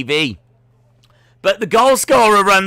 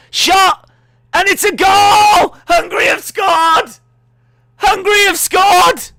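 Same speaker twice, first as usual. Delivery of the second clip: big excited goal yell, high and loud; shouting the goal at full volume; 13 semitones higher, 6 dB louder than usual. Real, not acted. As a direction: roar the goal like a live commentator. Live goal celebration shouting.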